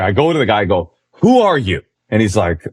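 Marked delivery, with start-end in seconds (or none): 1.2-1.8 retold challenge. Confronts the name thief.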